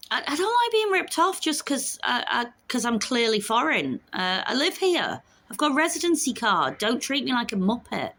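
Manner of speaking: real indignation. Real, not acted. Indignant about being ripped off.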